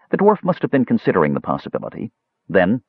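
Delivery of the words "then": ordinary delivery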